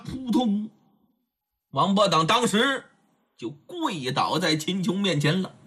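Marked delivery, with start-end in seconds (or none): none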